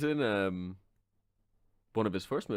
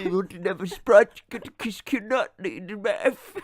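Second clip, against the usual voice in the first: silly Depp advert voice; 3 semitones higher, 4 dB louder than usual. Pretend.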